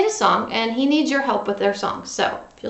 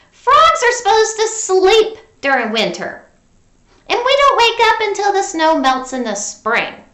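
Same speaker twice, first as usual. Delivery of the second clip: mother's voice; 8 semitones higher, 6 dB louder than usual. A narrator's reading. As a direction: tell the frog child to sleep. Mother explains to Froggy.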